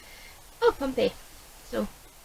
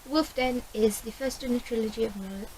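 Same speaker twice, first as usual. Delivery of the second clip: usual speaking voice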